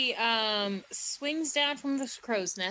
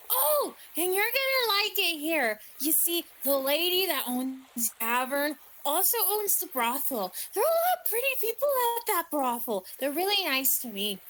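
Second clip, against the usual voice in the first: put-on eager, high-swooping child's chatter; perky little Tamias; 5 semitones higher, faster than usual. Little Tamias perks up at talk of brothels, excited. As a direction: gush about it, quick and excited. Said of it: "Tobias immediately perks up".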